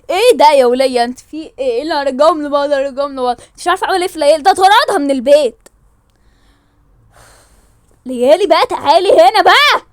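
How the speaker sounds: loud, exasperated scolding; angry scolding. Acted. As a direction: tell her off hard. Family quarrel over Layali. (زعيق غاضب على ليالي)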